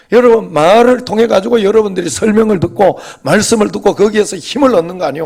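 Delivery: high, forceful sermon delivery; emphatic preaching. Real, not acted. Pastor preaching on the power of the Word, emphatic. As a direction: proclaim it to the congregation.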